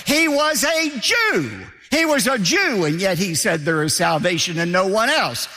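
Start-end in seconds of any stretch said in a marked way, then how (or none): 0.1-2.8 preacher's shout. Real, not acted. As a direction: ring it out like a revelation to the congregation. Preacher hammering the point home.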